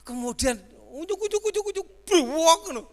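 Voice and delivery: high loud sputter like a struggling engine; high, loud mock engine sounds; sputtering motor noise. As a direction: mimic the old motorbike choking, ujuk ujuk. Imitating vehicle sputtering noise.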